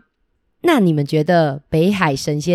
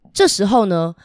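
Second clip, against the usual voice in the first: ordinary delivery, nothing marked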